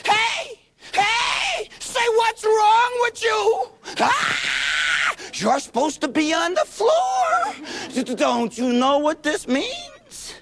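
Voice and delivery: put-on very high, crying, shouted toddler; frantic toddler. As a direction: cry out like a panicked two-year-old. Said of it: "normal little two-year-old buddy ... going".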